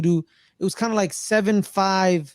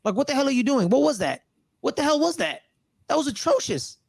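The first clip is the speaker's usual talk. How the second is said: higher-pitched mock telling-off without real volume; imitated yelling; 6 semitones higher than usual. Coach yelling at his quarterback on the sideline, imitated yelling. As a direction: act out a coach's exasperated scolding. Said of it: "he was yelling at him on the sideline".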